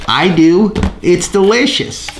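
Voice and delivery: hamming up a casual product plug; mock ad pitch. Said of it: "I'm just gonna, like".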